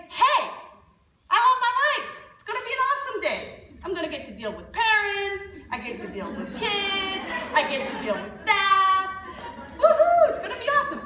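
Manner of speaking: high, loud, delighted pep as if jumping out of bed happy; upbeat morning joy. Shown as a demonstration. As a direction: greet the day like it's the best one ever, with a loud woohoo. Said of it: "I can also choose to get up".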